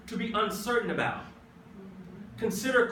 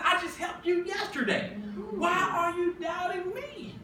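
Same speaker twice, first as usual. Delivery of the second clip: pleading Jesus; 7 semitones higher, 4 dB quieter than usual. Retold or imitated. Jesus asking why they doubt after yesterday's help, high and pleading. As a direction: ask it with aching disbelief. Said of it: "he said".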